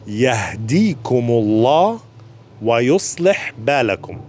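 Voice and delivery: Yahdikumullah wa yuslih balakum spoken slowly and clearly; careful, unhurried Yahdikumullah wa yuslih balakum. Shown as a demonstration. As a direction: take the dua slowly for learners.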